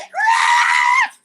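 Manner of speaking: sudden high furious shriek; a pissed-off high scream; angry scream. Retold or imitated. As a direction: let out a loud enraged yell.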